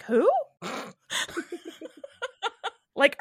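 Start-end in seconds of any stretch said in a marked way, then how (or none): 0.0-0.3 hammed-up crowd voice; mock classmates. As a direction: blurt it like baffled classmates. Mocking classmates' reaction.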